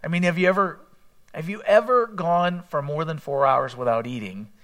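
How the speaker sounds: ordinary talk, nothing marked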